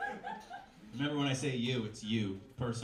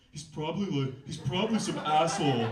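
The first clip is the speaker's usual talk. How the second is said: a lightly voiced grouchy roommate, louder; a hint of a jealous, grouchy roommate; Marcy's voice; 3 semitones higher, 7 dB louder than usual. Roommate grumbling to her friend, lightly voiced jealous grouch. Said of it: "oh, I should do Marcy's voice".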